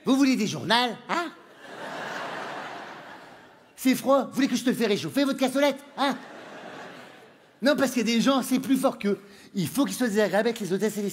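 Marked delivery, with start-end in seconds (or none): 0.0-6.2 put-on deliberately rude Northern accent. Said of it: "Quand je parle mal, je fais exprès".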